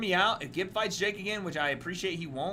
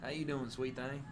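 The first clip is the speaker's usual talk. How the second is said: cowboy voice; 4 semitones lower, 10 dB quieter than usual. Pretend. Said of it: "He just turns into an impression of his dad".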